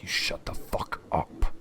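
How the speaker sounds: hissed whisper, vicious; whispered snap. Acted. Boss hissing at his underling, whispered snap. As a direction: whisper it like a blade. NPC snaps at henchman.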